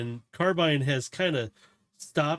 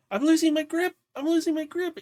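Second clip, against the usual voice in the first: high, loud panicked squeal; acted high-pitched alarm, repeated urgently; panicked cry; 6 dB louder than usual. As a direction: cry out as your hold slips. [name] sliding off, calls out.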